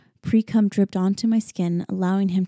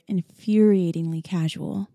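ordinary delivery

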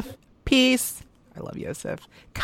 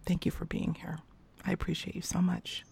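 whispered, much softer, real gratitude; 9 dB quieter than usual. Real, not acted. Host whispering heartfelt thanks to her guest Billy, soft whisper. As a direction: breathe the thanks out tenderly.

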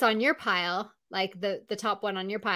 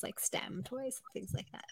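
whispered aside; 16 dB quieter than usual. Real, not acted. Host tacking a whispered aside onto next week's topic. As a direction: drop to a whisper for the afterthought. Trailing aside at show's end.

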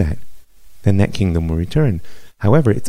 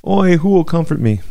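even higher, loud keening lament; echoing wail; 9 semitones higher, 3 dB louder than usual. A narrator's reading. Jeremiah joining the weeping woman, high loud wail. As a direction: cry out the grief back to her. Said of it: "And I cried".

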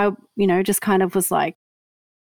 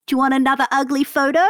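quick, higher mock offer; teasing mum quote; 5 semitones higher, faster than usual. Guest mimicking her mum offering photos. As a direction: rattle off the offer like a cheeky mum.

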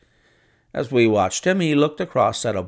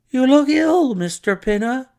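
much higher, louder startled exclaim; surprised exclamation; 13 semitones higher, 5 dB louder than usual. A narrator's reading. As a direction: blurt it out in alarm, pitched high. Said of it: "he exclaimed".

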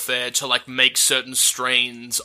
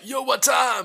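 mock shout; 10 semitones higher, slower than usual. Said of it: "threw himself out and was like".

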